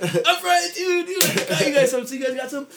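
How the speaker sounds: goofy high excited. Retold or imitated. Telling buddies he brought acid too, high giddy voice. Retold excited stoner talk.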